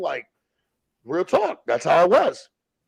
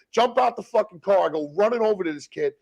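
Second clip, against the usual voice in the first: normal talking voice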